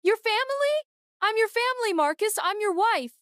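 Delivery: bitter half-laughing shriek; broken hysterical cry. A narrator's reading. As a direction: screech it out in broken disbelief. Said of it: "horrible, broken sound".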